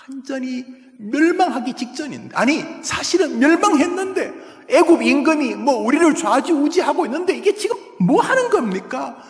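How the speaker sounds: high, loud cry of exasperation; anguished outcry. Acted. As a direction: rail against the foolish king. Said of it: "하면서 하나님 앞에 막 원망, 절규".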